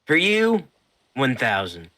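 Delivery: high, loud merchant voice; shopkeeper voice. Acted. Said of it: "And he says".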